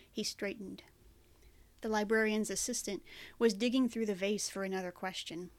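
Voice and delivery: usual speaking voice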